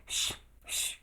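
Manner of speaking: soft whispered shh; shushing. A narrator's reading. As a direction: hush her sharply under your breath. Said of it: "the Hatter and the March Hare went".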